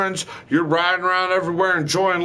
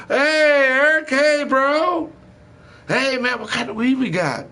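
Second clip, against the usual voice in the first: mocking high voice; 10 semitones higher than usual. To his buddy Eric, mimicking a caller begging for weed, in a squeaky high mock voice.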